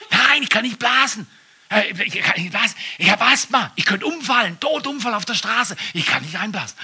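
put-on high, loud, protesting drunk driver; panicky drunk excuses. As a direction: plead frantically with the cop. Drunk driver pleads with cop.

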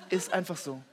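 ordinary delivery

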